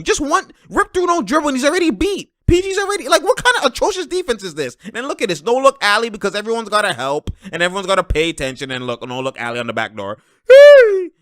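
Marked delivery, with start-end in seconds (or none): 0.0-4.8 high-pitched exasperated rant. Real, not acted. Analyst picking apart the Clippers' atrocious defense, exasperated. 10.5-11.1 high, loud "hey"; excited whoop. Real, not acted. Analyst reacting to a no-look alley-oop, excited whoop. Cheering a highlight play.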